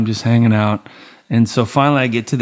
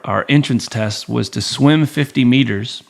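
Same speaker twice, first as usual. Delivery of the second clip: normal talking voice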